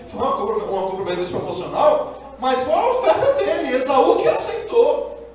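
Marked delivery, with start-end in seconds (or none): 2.4-5.2 loud, higher preaching peak. Real, not acted. Preacher to congregation, loud and higher pitched. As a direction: raise it to the rafters.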